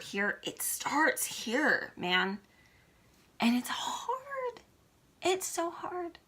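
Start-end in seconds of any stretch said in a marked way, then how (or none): 3.4-6.2 \"And it's hard\" whispered through tears, voice breaking high; fighting back tears, almost no voice; tearful whisper. Real, not acted. Emotional about weight loss.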